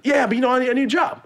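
very high, loud eager mock voice; imitating eager guy. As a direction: squeak it out like a desperate guy. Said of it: "He was like".

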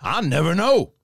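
drawling Southern-accented movie-star impression; Al Pacino. Co-host demonstrates the actor's Southern accent turn, Pacino impression. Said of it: "a Southern accent somewhere".

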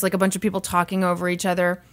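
ordinary delivery

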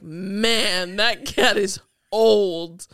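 loud, slow, exaggerated quote; hammed-up drawn-out line; being dramatic. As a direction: drag out the words for comic drama. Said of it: "obviously I'm j-, being dramatic".

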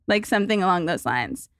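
normal talking voice

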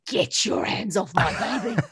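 mock Cockney threat with a laugh; lightly Cockney, protective mother. New mother warning off the guard in Cockney, laughing. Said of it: "I don't know why I went Cockney".

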